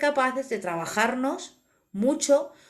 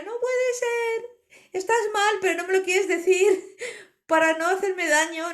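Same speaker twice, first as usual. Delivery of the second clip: much higher, wailing with a sob; crying plea; 8 semitones higher than usual. Retold or imitated. Begging the dolphin to admit she's unwell, sobbing high. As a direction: plead through real-sounding sobs, voice way up. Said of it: "Y yo lloraba y le decía".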